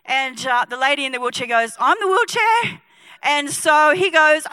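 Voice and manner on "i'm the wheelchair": high-pitched acted woman's reply; eager volunteer. Lady in the wheelchair answering the preacher, eager volunteer. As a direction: pipe up high as the lady called out.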